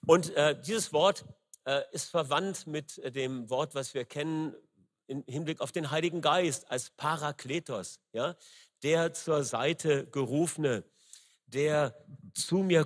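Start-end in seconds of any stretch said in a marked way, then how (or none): none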